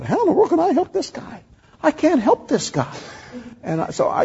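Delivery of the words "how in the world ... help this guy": much higher, fretful inner voice; high-pitched mock panic at the task; retold panic. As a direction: replay your own frightened thought. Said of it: "I was afraid to say".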